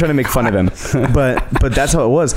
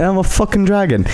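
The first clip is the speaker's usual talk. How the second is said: higher-pitched imitated British accent; deadpan dragon with a put-on British accent; 7 semitones higher than usual. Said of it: "a British accent".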